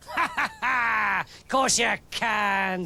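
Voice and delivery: slow, hammed-up booming laugh; king laughing at David in armour; hearty mock laugh. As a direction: laugh it off at the boy.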